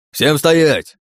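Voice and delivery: high, loud bellow to halt; roaring command. Acted. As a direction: roar the order at the whole field. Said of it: "взревел старший демон".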